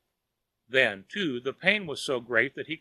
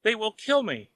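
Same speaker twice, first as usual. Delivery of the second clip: high frightened cry; panicked wail; 9 semitones higher than usual. A narrator's reading. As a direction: cry it in terror. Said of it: "wailed Wang".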